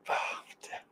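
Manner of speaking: exasperated whisper. Real, not acted. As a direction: breathe it out in despair. Exasperated aside.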